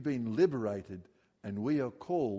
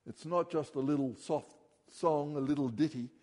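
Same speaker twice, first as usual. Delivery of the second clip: ordinary talk, nothing marked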